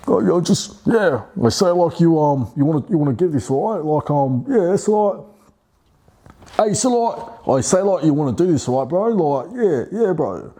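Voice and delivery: high, laid-back bro chatter; casual bro voice. Shown as a demonstration. Showing a persona moniker, high bro chat. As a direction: lean into a chill bro persona.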